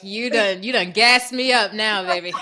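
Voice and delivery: ordinary talk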